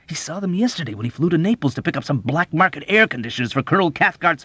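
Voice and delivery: urgent disclosure building to high, loud excitement; frantic, loud insistence; would-be furtive whisper. A narrator's reading. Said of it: "what he imagined was a furtive whisper".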